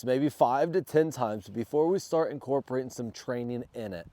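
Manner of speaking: ordinary delivery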